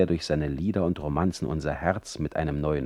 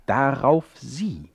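usual speaking voice

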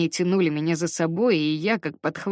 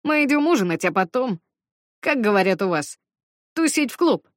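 excited; 5 semitones higher, 4 dB louder than usual. Acted. Schuyler excited invitation.

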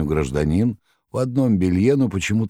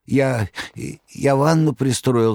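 higher, slow stammering cry; terrified cry; 9 semitones higher, slower than usual. Acted. Magarych crying out in terror, high stammer. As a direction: teeth chattering with fright. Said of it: "стуча зубами, кричал".

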